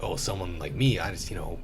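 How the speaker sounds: ordinary delivery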